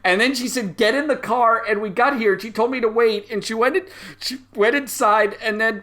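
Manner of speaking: put-on high, loud, upset boy's voice; sobbing kid pleading about his mom; crying child.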